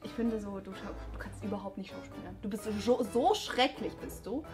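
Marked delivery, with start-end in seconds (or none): none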